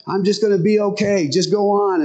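a hint of stubborn faith; stubborn swimmer. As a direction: wave the boat away, lightly voiced. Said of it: "And he said".

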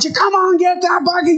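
high, frantic yell over the chaos; strained high-pitched shout to the crew. Acted. Guys on a sinking boat to each other, yelling. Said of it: "guys yelling".

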